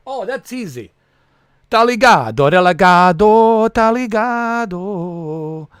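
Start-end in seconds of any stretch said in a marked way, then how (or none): 1.7-5.7 Portuguese catchphrase. Pretend. Acting out a Portuguese phrase.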